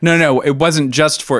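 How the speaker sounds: suddenly loud and high, protesting. Real, not acted. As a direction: blurt it out, voice rising. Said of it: "Don't raise your voice".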